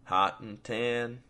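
measured, unhurried storyteller reading; story narration. Acted. Host reading his story aloud to listeners, measured narration. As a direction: read it like an audiobook narrator, steady and even.

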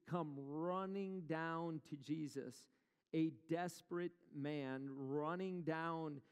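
ordinary talk

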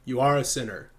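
usual speaking voice

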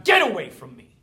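sudden loud push-back, get away; defiant shout. Acted. Telling the doubt gorilla to back off, shouted. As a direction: shove the gorilla off with your voice. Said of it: "grab that gorilla by the head and just be like".

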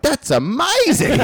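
very high, slow, syrupy praise; condescending teacher voice. Retold or imitated. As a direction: talk down like to a preschooler.

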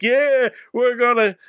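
drawn-out mock-heroic cheer; corny hero rallying cry; gung-ho cops. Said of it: "all the cops are like".

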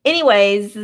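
"Anyways" said firmly, ending on z. Shown as a demonstration. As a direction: say "Anyways" with the z sound. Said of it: "Do you guys say".

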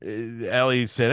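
ordinary delivery

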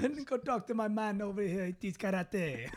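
easygoing Jamaican shopkeeper; Jamaican accent. Pretend. Pointing customer to dad, laid-back Jamaican accent. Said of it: "You do the accent better".

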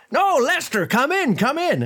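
very high, welcoming call; effusive host. Acted. Host welcomes yet another guest, high and effusive. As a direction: wave them in with a high, gushing voice. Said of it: "He's like".

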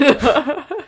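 loud high laugh; burst of laughter. Real, not acted. Laughing at the misread line.

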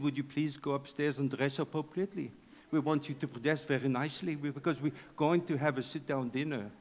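low, soft, badly faked French accent; mangled French accent, kept quiet; butchered French accent. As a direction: play a hammy French host, voice dropped low. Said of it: "a very butchered French accent".

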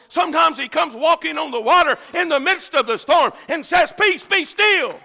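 high, driving sermon cadence, slowing on the last words; full-force preaching. Real, not acted. Preacher tells how Jesus comes in the storm, impassioned and high. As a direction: drive it home, then stretch out the ending.

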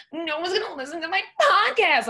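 high, loud mocking whine; annoying, stupid voice. Shown as a demonstration. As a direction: make the worry sound ridiculous, squealed high. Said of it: "annoying and irritating and stupid".

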